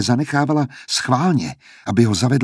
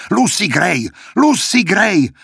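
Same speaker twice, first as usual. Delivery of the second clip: furious shouting; 9 semitones higher, 3 dB louder, slower than usual. A narrator's reading. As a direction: shout the name twice, rising much higher and louder. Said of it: "Vztekle se otáčel". (zuřivý křik, stále výš a hlasitěji)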